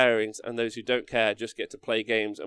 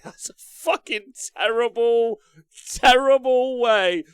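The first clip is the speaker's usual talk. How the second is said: drawn-out, much higher and louder outrage; exaggerated disgust; 12 semitones higher, 11 dB louder, much slower than usual. Real, not acted. Streamer mocking a terrible tactic, slow loud disgust. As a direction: stretch each word out like it offends you.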